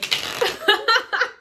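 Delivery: a sudden high giggle at herself; high embarrassed laugh. Real, not acted. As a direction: burst of high squealing laughter. Embarrassed after channeled outburst.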